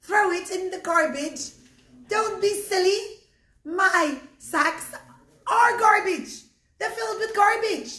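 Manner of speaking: high, loud, mocking old lady; scoffing old woman. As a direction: scoff at a silly suggestion, loudly. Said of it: "starts to laugh and she goes".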